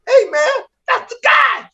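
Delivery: very high-pitched imitated passenger; excited call-out. Train passenger pointing out the comic to others, excited high cry. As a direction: squeal it in mock recognition.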